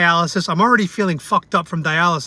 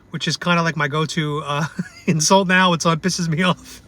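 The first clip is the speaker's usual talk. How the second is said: ordinary talk, nothing marked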